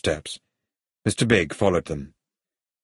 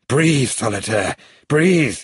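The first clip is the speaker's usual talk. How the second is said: shouted plea, rising to a higher, louder cry; urgent shout; 5 semitones higher, 4 dB louder, slower than usual. A narrator's reading. Hero urges companion as boat speeds up, shouting. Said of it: "he shouted".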